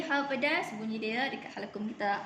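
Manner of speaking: ordinary talk, nothing marked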